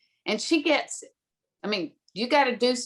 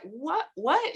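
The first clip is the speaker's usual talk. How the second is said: baffled; 5 semitones higher than usual. Retold or imitated. Parents baffled by the assignment.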